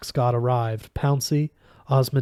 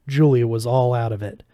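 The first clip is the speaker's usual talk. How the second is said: usual speaking voice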